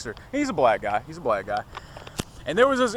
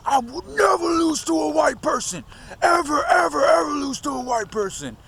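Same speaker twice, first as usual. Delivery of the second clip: much higher and louder, a screamed mock rant; furious shout; 12 semitones higher, 4 dB louder than usual. Vlogger shouting the boxer's enraged vow at his viewers. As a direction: yell it like the enraged boxer, almost cracking. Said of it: "he wasn't just saying it, he was, like, angry".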